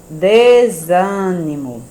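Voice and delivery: "Desânimo" very slow and loud, ZA drawn out; read aloud, syllables stressed. Shown as a demonstration. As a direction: stretch "Desânimo", leaning hard on the ZA. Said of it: "Lê em voz alta as palavras".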